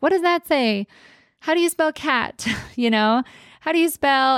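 playful imitation of a child asking; eager child. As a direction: ask like an eager little kid. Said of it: "they've even started asking".

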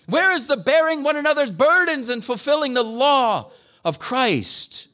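high, ringing preacher's cry, each question pushed harder; impassioned preaching. Acted. Pastor pleading with his church, at full preaching force. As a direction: rouse the congregation like a revival preacher.

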